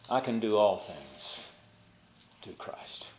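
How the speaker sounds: quiet, fading into a whisper on through Christ; hushed inner prayer. Acted. Preacher voicing Stephen's silent prayer, fading to a whisper. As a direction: let the faith sink to a whisper.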